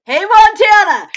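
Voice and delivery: loud, drawn-out call across the room; loud greeting. Real, not acted. Greeting chat viewer Mary with a loud, drawn-out call. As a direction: hail her by name, big and slow.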